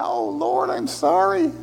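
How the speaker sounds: sorry sinner. Retold or imitated. Believer caught sinning apologizes to God, high pleading. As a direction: play the scolded believer begging pardon.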